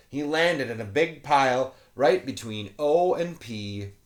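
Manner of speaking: usual speaking voice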